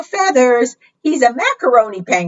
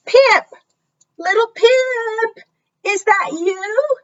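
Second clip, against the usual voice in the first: high, drawn-out calling for a lost chick; calling parents; slower than usual. A narrator's reading. Parent penguins calling out for their lost little Pip, high and drawn out. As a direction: call across the ice, searching.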